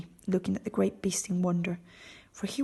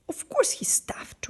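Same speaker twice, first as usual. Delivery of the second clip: still angry; 14 semitones higher, slower than usual. A narrator's reading. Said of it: "who was still angry".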